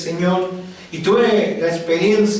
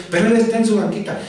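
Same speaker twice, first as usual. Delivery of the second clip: ordinary talk, nothing marked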